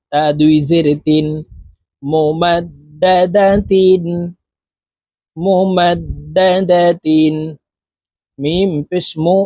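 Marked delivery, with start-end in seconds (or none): 2.0-7.4 drawn-out Quranic recitation, syllable by syllable; slow model recitation. Shown as a demonstration. Teacher reciting the assembled word for learners, slow chant. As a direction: stretch each syllable evenly, half-chanted.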